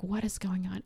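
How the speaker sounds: hushed mock bewilderment; baffled mom. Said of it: "his mom is looking at him like".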